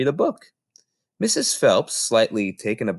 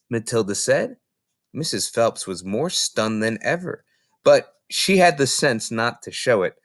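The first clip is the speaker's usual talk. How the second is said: usual speaking voice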